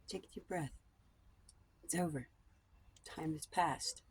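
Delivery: soft breathy whisper, nearly voiceless; whispered breathing cue. Shown as a demonstration. Guiding a viewer to calm down, in a whisper. Calming listener with breathing advice.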